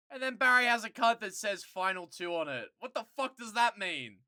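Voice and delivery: loud disbelieving rant; outraged rant. Real, not acted. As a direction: rant about it, pitch up. Outraged at card text.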